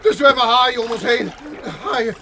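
high, loud panicked cries; terrified. Acted. Professor in the water spots sharks, terrified. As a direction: shout about the sharks in terror.

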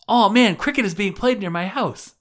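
high, delighted gush; retold excitement. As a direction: recall being thrilled by the discovery. Retelling own delighted reaction to neighbors.

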